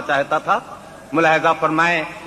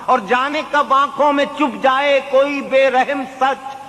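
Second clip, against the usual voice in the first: poetry recitation; 9 semitones higher than usual. Acted. Mushaira recitation.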